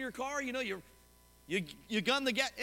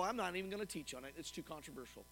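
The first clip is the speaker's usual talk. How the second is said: muttered, much softer and lower, a pastor brushing it off; a quiet, lowered aside of a timid preacher; dismissive pastor; 8 semitones lower, 10 dB quieter, faster than usual. Retold or imitated. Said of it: "I've heard some pastors go".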